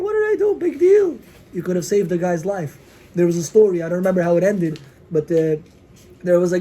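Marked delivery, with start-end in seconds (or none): 0.0-1.2 high-pitched mock shrug; dismissive thought. Giver brushing off one small kind deed, dismissive. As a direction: toss it off in a much higher voice.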